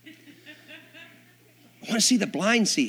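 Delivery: slow, high, laughing cry of longing; excited declaration. Real, not acted. Preacher to congregation, slow laughing high cry. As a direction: stretch it out with a laugh.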